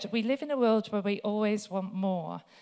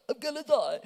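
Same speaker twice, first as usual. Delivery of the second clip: panicked gasp; 4 semitones higher than usual. Retold or imitated. Panic at skydive jump.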